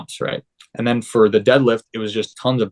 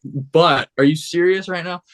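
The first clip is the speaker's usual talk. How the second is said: higher, slow mock exasperation; a hammed-up are you serious; exasperated disbelief; 7 semitones higher than usual. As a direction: drag it out, half-joking outrage. Retold exasperation at coach's critique.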